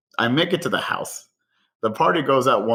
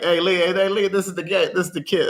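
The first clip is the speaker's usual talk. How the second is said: higher-pitched, eager imitation; mock excitement, pitched up; retold excited pointing-out; 6 semitones higher than usual. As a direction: re-enact flagging someone down to show them. Chef excitedly pointing out the culprit.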